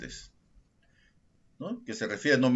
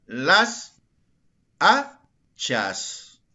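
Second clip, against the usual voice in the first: "hachas" split in two, loud first A; stress on HA; 2 semitones higher, 5 dB louder, much slower than usual. Shown as a demonstration. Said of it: "Mayor fuerza de voz en la A". ("hachas" silabeado, con fuerza en la primera A)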